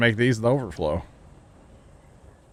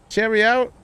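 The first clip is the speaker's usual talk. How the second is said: very high, loud mock British accent; 13 semitones higher, 6 dB louder than usual. Gamer reacting to new Cheerios, mock British accent. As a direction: squeal it in hammed-up British. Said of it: "with my terrible British accent".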